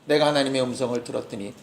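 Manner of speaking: hushed in wonder at God's voice; Habakkuk telling God his amazement, softly; awed, quiet prayer. Retold or imitated. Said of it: "그의 노래가 삼 장에서".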